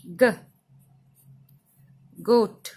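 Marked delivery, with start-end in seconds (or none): 0.1-0.3 "ग" as a short hard guh; phonic G sound. Shown as a demonstration. Teacher showing the sound of G, "ग" sounded out. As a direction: sound out "ग" on its own. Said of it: "जी का sound होता है".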